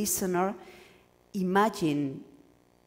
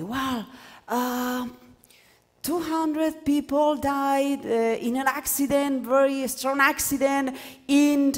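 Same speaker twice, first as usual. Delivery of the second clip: higher, slow, halting announcer voice; radio news read; 8 semitones higher, 3 dB louder, slower than usual. Shown as a demonstration. Teacher mimicking a radio announcer burying the main information. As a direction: read it like a hesitant radio newsreader. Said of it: "when you are listening to the radio".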